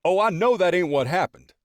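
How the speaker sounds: high, loud doubting friend; grinning friend. A narrator's reading. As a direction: call the bluff with a grin. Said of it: "Tyrone grinning".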